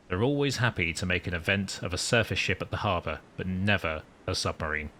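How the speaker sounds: deliberate, composed storytelling; captain's log narration. Acted. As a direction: narrate it like a submarine commander's log.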